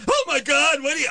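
high mock gasp of horror; mock shocked pedestrian. Pedestrian mocked gasping at passing van. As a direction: play the clutching-pearls walker. Mocking complainers.

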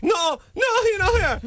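very high-pitched panicked denial; terrified at gunpoint. Shown as a demonstration. Host showing the mother's part, hammed-up terror. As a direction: plead as if held at gunpoint. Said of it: "pretend like someone's holding a gun to you".

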